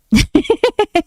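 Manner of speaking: high-pitched giggle; real high laugh. Real, not acted. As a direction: burst into a high, loud giggle. Amused by stream chat.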